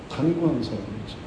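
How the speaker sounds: ordinary talk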